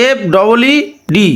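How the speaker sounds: letters spelled out very slowly one by one; careful slow spelling of F double E D; slow dictation. Shown as a demonstration. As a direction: dictate each letter slowly so they can type. Dictating hashtag letters to students.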